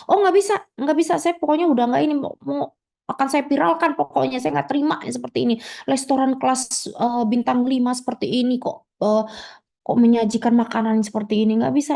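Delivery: very high, rapid indignant refusal; a customer who will not be calmed; angry refusal. Acted. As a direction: brush off the apology, shrill and fast.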